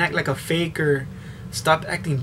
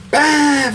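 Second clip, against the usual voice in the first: door slam imitation; 10 semitones higher, 5 dB louder than usual.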